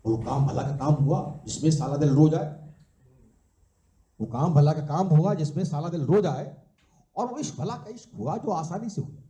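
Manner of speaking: measured, rhythmic verse with a lilting refrain; live poetry recital; poem recitation. Acted. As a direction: recite it as a couplet, landing on each rhyme.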